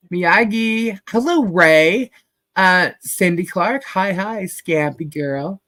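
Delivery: usual speaking voice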